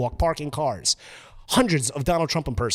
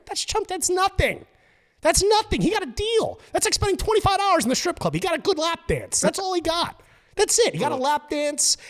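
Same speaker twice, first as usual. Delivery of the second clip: scoffing excitement; 12 semitones higher than usual. Real, not acted. Mocking the small price.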